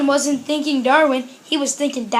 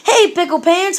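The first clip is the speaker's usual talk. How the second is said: loud, higher mama calling out; mama hollering; 4 semitones higher, 6 dB louder than usual. A narrator's reading. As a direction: holler after a running child. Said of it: "hollered Mama".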